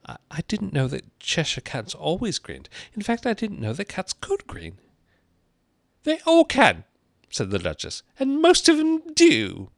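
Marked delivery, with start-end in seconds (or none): 0.1-4.8 put-on high, hesitant girl's voice. A narrator's reading. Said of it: "she took courage and went on again". 6.0-6.9 loud, high-pitched character voice. A narrator's reading. Duchess brusquely corrects Alice. 8.2-9.7 slow, very high, loud squawk. A narrator's reading. Duchess brusquely corrects Alice.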